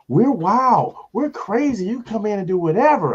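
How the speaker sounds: high, loud, hyped-up woman; excited client. Retold or imitated. Client promises the comic a wild crowd, excited.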